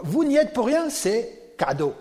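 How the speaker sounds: soaring emphatic preacher's cry; preaching burst. Real, not acted. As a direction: hit the hall with it. Preacher driving the point home.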